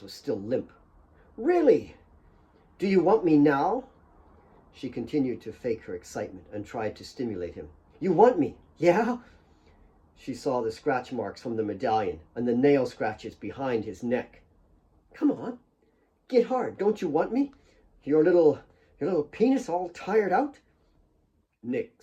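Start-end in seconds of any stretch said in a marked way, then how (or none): 1.4-3.8 faked excitement. Claire fakes excitement to Nick. 8.0-9.2 faked excitement. Claire fakes excitement. 15.1-20.6 mocking taunt. Claire taunts Nick mockingly.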